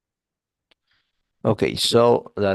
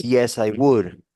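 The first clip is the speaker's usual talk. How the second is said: a sarcastic "sure I would", a little louder; 3 semitones higher, 7 dB louder, slower than usual. Shown as a demonstration. Teacher showing a student a wrong short answer: sarcastic. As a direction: lay on the irony as you agree. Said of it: "That's sort of sarcastic".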